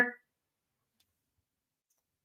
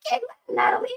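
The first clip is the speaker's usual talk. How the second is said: impressed; much faster than usual. Real, not acted. Reacting to Natalie Portman photo.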